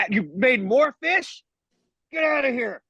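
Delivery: high-pitched, drawn-out mock outrage; comic exasperation, pitched well up; fed-up complaint. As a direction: shoo away an unwanted miracle worker. Acted villager fed up with fish.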